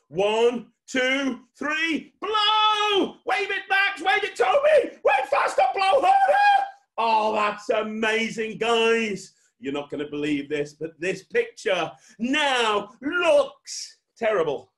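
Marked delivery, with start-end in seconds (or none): 0.1-6.7 countdown rising to high full shout; shouted urging. Real, not acted. Countdown and urging at magic climax. 7.0-9.3 loud, high, slowly stretched marvelling; amazed praise. Pretend. Hyped praise before reveal. 10.7-14.7 slow, loud, high showman reveal; suspenseful build-up. Pretend. Drawn-out build-up before bad reveal.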